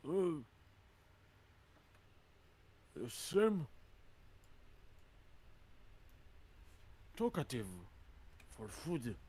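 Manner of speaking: slow, high-pitched puzzled giant; dim hill giant. Acted. Hill giant to his talking prey, slow puzzlement. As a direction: ponder it slowly as a dim giant.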